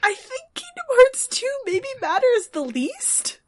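high, slow, laughing through tears; giggling so hard the voice cracks; laugh-crying. Real, not acted. Laughing-crying about game.

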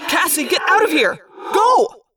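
high shouted order to flee; urgent yell. A narrator's reading. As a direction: yell to get a friend away. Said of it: "I yelled".

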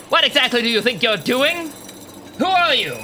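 alarmed guard challenging a stranger; indignant challenge. Acted. Guard confronts intruder at the button.

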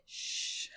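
drawn-out voiceless "shh"; shush. Retold or imitated. Man hushing the arguing woman, shushing. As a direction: imitate shushing someone.

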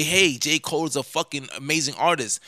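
ordinary delivery

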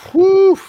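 very loud, high whew; big exaggerated whoosh of relief. Real, not acted. Exclaiming over a villain's badness.

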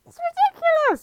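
very high-pitched indignant cry; exasperated, high squeal of protest; seething outburst. Acted. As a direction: burst out in outraged disbelief. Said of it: "Mallard seethed".

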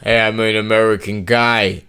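hammy fake American guy; mock American accent. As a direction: put on a badly convincing American accent. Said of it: "a very convincing American accent".

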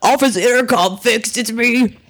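high, loud PA announcement; intercom voice. Acted. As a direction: boom it over the intercom. CEO over office intercom.

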